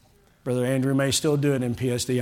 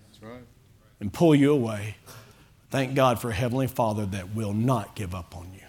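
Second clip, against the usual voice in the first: normal talking voice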